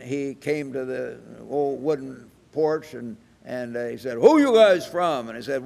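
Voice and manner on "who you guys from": higher, loud mock-hostile challenge; retold challenge. Old farmer confronting visitors on his porch, retold challenge. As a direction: fire it out like a suspicious farmer.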